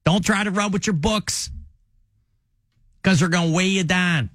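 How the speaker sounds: comic cop admonishment; J J Bittenbinder. Retold or imitated. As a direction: caution kids like a safety lecturer. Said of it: "he would say".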